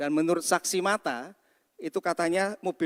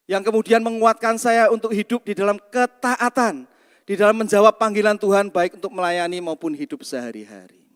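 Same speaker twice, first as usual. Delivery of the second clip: loud, raised proclamation; forceful preaching; 3 semitones higher, 7 dB louder than usual. Real, not acted. Pastor telling congregation how God saves sinners, forceful preaching. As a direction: drive the gospel point home at full voice.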